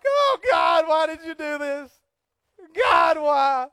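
high, loud wailing cry to God; hammed-up desperate wail at heaven; mock anguished shout of why; whining Joshua. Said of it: "Probably a lot of whining".

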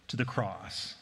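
ordinary talk, nothing marked

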